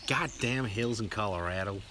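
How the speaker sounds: slow, put-on American drawl; American accent. Shown as a demonstration. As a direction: do your best American accent. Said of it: "do your best American accent".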